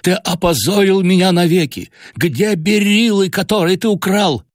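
very high, slowed, raging accusation; furious shouting. A narrator's reading. Father shouts that the son ruined and stole the gems. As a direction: hurl the blame at him. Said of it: "вскричал я вне себя от бешенства".

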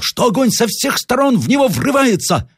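very high, urgent general; high-pitched exasperation; agitated interruption. A narrator's reading. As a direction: press the point in alarm, voice way up. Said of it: "перервал генерал".

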